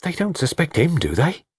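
high-pitched, earnest servant's plea; high, deferential protest; anxious pleading servant. Acted. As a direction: plead high for someone's innocence.

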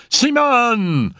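high, drawn-out call; anguished cry. A narrator's reading. Raoul calls to the screaming medium, alarmed cry. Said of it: "cried Raoul".

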